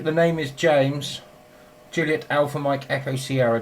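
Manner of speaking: name said slowly, then spelled out carefully; slow and clear. Real, not acted. Repeating his name slowly because the other station asked. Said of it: "Can you go and break on the name?".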